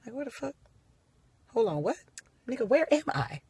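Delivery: softly muttered where-am-I; hushed confusion. Acted. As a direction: whisper it aside to the audience, baffled. Confused aside to the audience.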